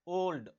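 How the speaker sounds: Old said loud with a full "o"; stressed O sound. Shown as a demonstration. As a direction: lean on the opening vowel of old. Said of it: "ओ sound आ रहा है".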